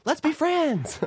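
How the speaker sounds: high, singsong social-media plug; Alexi Wasser impression. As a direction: mimic a host's perky sign-off, lifting high at the end. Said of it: "Do an impression of me".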